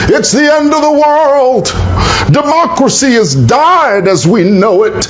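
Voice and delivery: high, hammed-up cry of doom; mock doomsayer. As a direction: lampoon a panicking prophet of doom. Mocking the opposition's doom talk.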